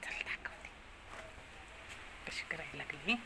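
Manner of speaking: hushed whisper so the bird won't hear; whisper, creeping up quietly. Real, not acted. Sneaking up on the bird at home. (हळू कुजबुजत)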